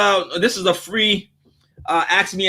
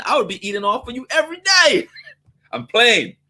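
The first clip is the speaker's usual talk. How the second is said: ordinary delivery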